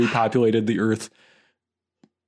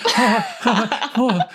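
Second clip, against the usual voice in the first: high, exaggerated ha-ha laugh; mock laughter; 12 semitones higher, 3 dB louder, much slower than usual. Host teasing guest for enjoying company after a year, mock laughter. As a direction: act out giddy, overdone laughing. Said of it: "you were like".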